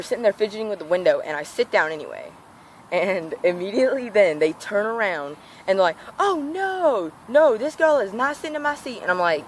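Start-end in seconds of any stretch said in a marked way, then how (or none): 6.2-9.0 put-on high, sneering mean-girl voice; hammed-up snotty girl refusing her seat; imitating the bully, pitched way up; mocking mean girl. Mocking the girl who hates her.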